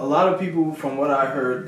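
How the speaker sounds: ordinary talk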